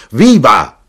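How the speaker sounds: loud, high cheer; shout. A narrator's reading. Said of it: "Qui l'arrotino gridò di nuovo".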